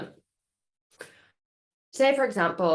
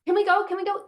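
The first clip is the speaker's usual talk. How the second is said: put-on much higher, eager kid pleading; begging child; 11 semitones higher, faster than usual.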